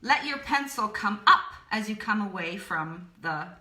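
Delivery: ordinary delivery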